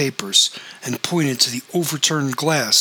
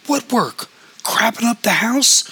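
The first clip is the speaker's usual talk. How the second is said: angry scold; 10 semitones higher, 3 dB louder than usual. Acted. Mother scolding son in quarrel.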